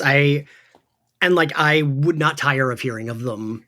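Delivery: ordinary talk, nothing marked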